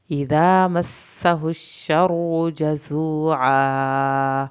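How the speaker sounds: slow, loud, drawn-out tajweed chant; Quran recitation. Shown as a demonstration. Teacher recites aloud for students, slow loud chant. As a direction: chant each word slowly for the class to follow. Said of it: "teacher models the recitation".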